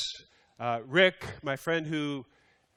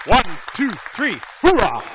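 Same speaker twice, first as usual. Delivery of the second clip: loud, higher group count-off and cheer; rally cheer; 5 semitones higher, 8 dB louder than usual. Real, not acted. Pastor leads the crowd in welcoming the new group, cheering loudly. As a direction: pump up the room with a loud hoorah.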